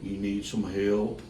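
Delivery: put-on low, slow old-timer drawl; old man in the bleachers. Old bleacher man asks the host if he needs help, low and slow. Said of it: "The other one looks at me and goes".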